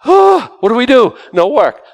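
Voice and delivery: high, alarmed cry of confusion; mock panic over a day off; panicked freed slaves. Freed slaves at a loss over no work, alarmed and high. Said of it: "And they think like".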